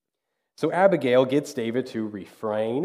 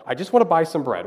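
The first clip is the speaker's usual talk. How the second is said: quick, higher-pitched exasperated protest; mock angry; 4 semitones higher, 4 dB louder, faster than usual. Shopper with a line forming behind them, mock angry protest. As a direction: imitate a flustered shopper losing patience. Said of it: "they just get so angry".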